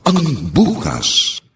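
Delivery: sweeping, emotive narrator read; dramatic radio narration. Acted. Narrator introduces the drama's theme over music, sweeping and emotive. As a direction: build hope through the speech.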